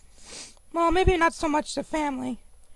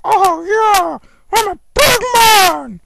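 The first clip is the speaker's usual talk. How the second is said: louder, slow mock booming shout; proud big man; 2 semitones higher, 8 dB louder, slower than usual. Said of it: "wake up one day and be like".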